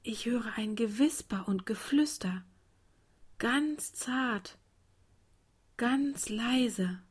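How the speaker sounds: hushed whisper rising to a tender softness; very soft, delicate child's murmur. A narrator's reading. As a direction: whisper gently, letting some voice creep in. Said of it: "flüsterte das kleine Gänseblümchen".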